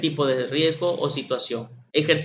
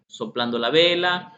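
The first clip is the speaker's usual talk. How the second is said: normal talking voice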